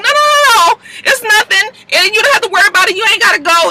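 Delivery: high, loud, frantic pleading; pleading man voice. Retold or imitated. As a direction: beg her not to leave. Man pleading she stay.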